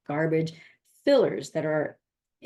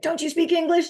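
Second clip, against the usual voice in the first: mock Canadian accent, pitched way up and louder; funny Canadian brogue; 12 semitones higher, 5 dB louder than usual. Mentor teasing the confused listener, in a playful Canadian accent. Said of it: "In this funny, funny Canadian, uh, brogue".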